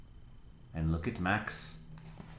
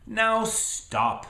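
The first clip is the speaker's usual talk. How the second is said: much higher firm stop; Max commanding; 13 semitones higher, 4 dB louder than usual. Acted. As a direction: order the wild things to halt. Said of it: "said Max".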